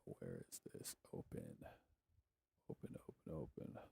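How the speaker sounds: voiceless whisper to himself. Real, not acted. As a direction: whisper while searching. Searching, voiceless under breath.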